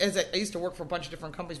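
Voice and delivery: ordinary delivery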